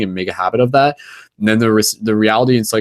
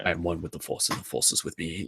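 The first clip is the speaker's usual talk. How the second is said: monkish Jedi voice; 2 semitones lower, 13 dB quieter than usual. Shown as a demonstration. Host re-demonstrates his Force line, monkish and hushed. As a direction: intone it like a Jedi monk.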